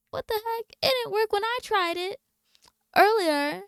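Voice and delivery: high-pitched, baffled replay of own reaction; indignant "what the heck"; mock exasperation.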